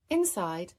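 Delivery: usual speaking voice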